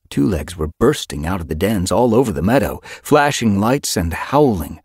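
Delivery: plain reading voice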